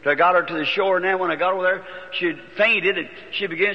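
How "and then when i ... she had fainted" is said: usual speaking voice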